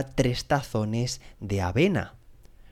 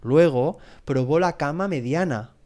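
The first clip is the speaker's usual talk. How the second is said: ordinary delivery, nothing marked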